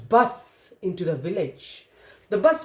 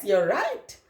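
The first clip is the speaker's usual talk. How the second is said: plain reading voice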